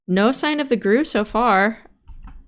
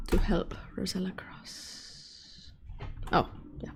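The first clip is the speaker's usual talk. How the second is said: whispering while hiding; 15 dB quieter, slower than usual. Real, not acted. Whispering during tense crossing.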